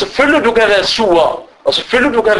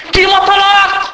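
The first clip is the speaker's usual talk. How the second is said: much higher insistent cry, hammed-up; mock demanding wife; 12 semitones higher than usual. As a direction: act the wife insisting on divorce.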